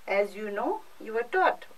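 usual speaking voice

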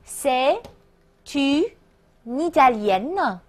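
C'est, une, Italienne in separate pieces with pauses; segmented C'est / une / Italienne, n starting the last word; broken-down liaison demo. Shown as a demonstration. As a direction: model C'est une Italienne slowly in chunks. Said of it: "注意连读连诵啊".

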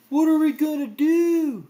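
loud, high cartoon wail; Shaggy moaning. A narrator's reading. Shaggy over the crushed snacks, moaning. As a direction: wail it in comic despair. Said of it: "Shaggy moaned".

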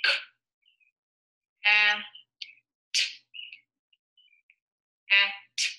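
each sound of "K, a, t" said apart with pauses, then "a, t" blended; sounding out letters. Shown as a demonstration. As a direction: segment the word for children, sound by sound. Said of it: "Remember the sound".

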